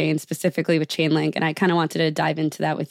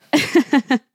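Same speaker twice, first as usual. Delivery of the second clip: bright high laugh; 8 semitones higher, 4 dB louder than usual. Real, not acted. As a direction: burst out laughing at the answer. Host laughs at answer.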